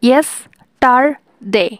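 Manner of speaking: Yesterday said very slowly in three parts; syllables drawn out. Shown as a demonstration. Teacher counting syllables for students, slow. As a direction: pull yesterday apart slowly. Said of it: "three syllable".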